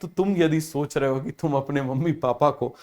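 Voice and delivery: ordinary delivery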